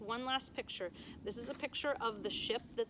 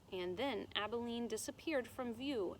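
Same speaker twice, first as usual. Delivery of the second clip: ordinary delivery, nothing marked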